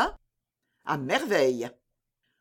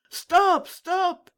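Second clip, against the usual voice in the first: very high-pitched cry; soldiers' shout; 12 semitones higher, 4 dB louder than usual. A narrator's reading. Soldiers still crying out after the escaped prisoners, shouting. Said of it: "les soldats criaient toujours".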